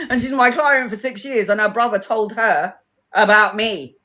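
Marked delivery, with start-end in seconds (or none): none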